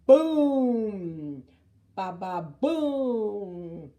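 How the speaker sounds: booming crash, very loud; loud thunder boom. A narrator's reading. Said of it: "Crashed the thunder".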